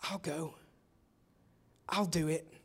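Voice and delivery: a hobbit stepping forward softly in a shouting crowd; Frodo's quiet voice. Retold or imitated. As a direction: offer it barely above a murmur, meekly. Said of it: "this quiet voice of Frodo".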